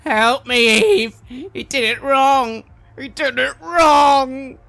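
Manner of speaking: high, loud, slow frantic cry for help; trapped soul shrieking for Eve; desperate screaming. Acted. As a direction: scream it from inside her head. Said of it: "a screaming".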